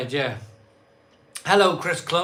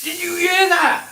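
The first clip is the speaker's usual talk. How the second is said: excited; 11 semitones higher, 5 dB louder than usual. Real, not acted. Excited about keg sound.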